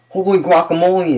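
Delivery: high, bright-eyed exclamation of amazement; excited exclaim. A narrator's reading. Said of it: "exclaimed Skippy John Jones".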